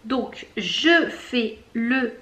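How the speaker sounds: ordinary talk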